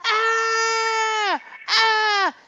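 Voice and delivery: high piercing screams, full volume; retold scream. Telling a ghost story, imitating friends' attic screams, piercing shout. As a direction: scream at the top of your lungs. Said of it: "them scream at the top of their lungs".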